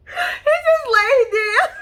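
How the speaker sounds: words squeezed out through laughter; can barely talk for laughing; laughing hard. Real, not acted. Laughing retelling of fall.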